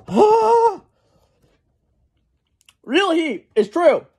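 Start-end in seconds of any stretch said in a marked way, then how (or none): 0.1-0.8 sharp high yelp of pain; pained cry. Real, not acted. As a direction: cry out from the burn. Burning from hot sauce.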